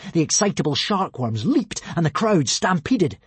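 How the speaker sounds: plain reading voice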